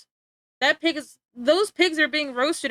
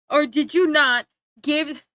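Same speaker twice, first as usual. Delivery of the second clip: mock interrogation; 4 semitones higher, 7 dB louder, slower than usual. Acted interrogation of Prometheus by Zeus.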